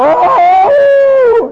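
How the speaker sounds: high, loud mock wolf howl "Oh!". Preacher imitates a wolf howl for the congregation, loud and high. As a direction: throw your head back and howl like a wolf. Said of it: "none go".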